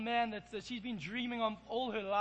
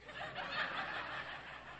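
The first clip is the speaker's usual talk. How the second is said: breathy high giggle; 9 dB quieter than usual. Real, not acted. Laughing at the burst tire.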